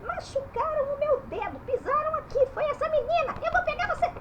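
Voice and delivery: high-pitched whiny puppet complaint; indignant puppet letter. Pretend. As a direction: tattle on a culprit in a high cartoon voice. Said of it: "O que foi, dona A?".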